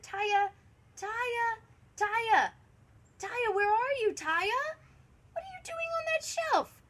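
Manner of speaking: high, loud searching call; worried dad hollering down the aisles; father calling out. A narrator's reading. Father searches, calls for daughter.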